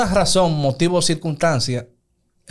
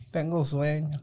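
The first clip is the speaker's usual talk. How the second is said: sleepy wife; 10 dB quieter than usual. Retold or imitated. Wife says she's sleepy.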